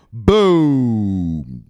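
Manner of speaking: drawn-out loud boom falling away; long shouted boom. Acted. Streamer to viewers promising gains, a long loud boom. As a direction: let the boom ring out.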